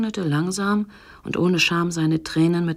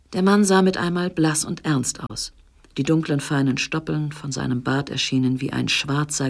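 plain reading voice

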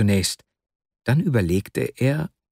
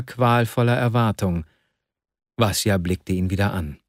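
plain reading voice

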